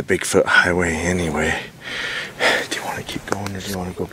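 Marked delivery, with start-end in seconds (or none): 2.7-4.1 fast hushed whisper. Real, not acted. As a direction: whisper it quickly so nothing hears. Whispering at night in woods.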